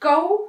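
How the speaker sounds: teacher modelling the कौ sound; clear demo of कौ. Shown as a demonstration. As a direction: sound out कौ for children. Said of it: "okay, जैसे".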